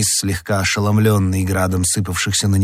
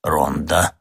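lower, absent-minded repetition; mechanical echo; 4 semitones lower than usual. A narrator's reading. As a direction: echo it automatically, without thought. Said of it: "машинально повторил Кюре".

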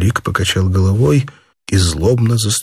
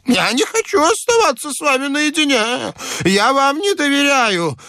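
high, hysterical accusation; very high-pitched old man's protest; panicky old man. A narrator's reading. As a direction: wail in suspicion and fear.

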